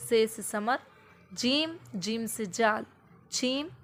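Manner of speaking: slow sing-song alphabet chant; teacher chanting alphabet to a child; slow teaching drill. Shown as a demonstration. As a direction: chant as on the first day with the child. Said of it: "पहला दिन आपका start है".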